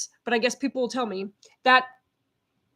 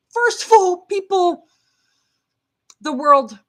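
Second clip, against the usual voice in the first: higher, louder, fired up; feisty and loud; 6 semitones higher, 8 dB louder than usual. Real, not acted. Vlogger to her followers, a feisty loud burst. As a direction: rev up the crowd, feisty. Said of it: "I'm a little bit loud".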